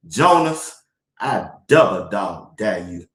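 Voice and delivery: low, slow, drawn-out scolding dare; lightly voiced stern grandma; grandmama scolding. Grandmama dares preacher husband, low and slow. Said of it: "would look at him and say".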